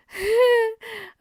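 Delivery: sudden high burst of laughter; bright laugh. Real, not acted. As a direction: laugh at yourself, caught out. Laughing at own admission.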